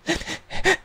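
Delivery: high, soft fake sobs; mock sobbing. Commentator mimics woman crying once guys check on her, mock sobbing. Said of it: "Didn't hear any sobbing or crying".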